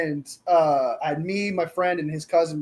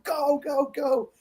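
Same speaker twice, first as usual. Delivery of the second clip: high-pitched urging, word by word; mock excited chant; excited cheering; 9 semitones higher, much slower than usual. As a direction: imitate a friend cheering on a runner. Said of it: "he was just excited".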